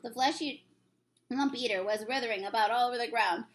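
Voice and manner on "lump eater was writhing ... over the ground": ordinary delivery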